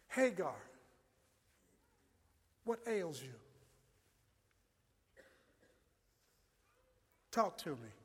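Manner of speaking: gentle God. Acted. God gently asks crying Hagar.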